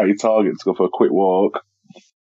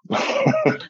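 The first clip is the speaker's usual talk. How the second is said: nervous laugh; 3 dB quieter than usual. Real, not acted. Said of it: "I'll be nervous laugh again".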